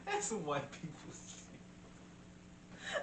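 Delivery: wheezing through laughter; squeezed out mid-laugh. Real, not acted. Laughing hard.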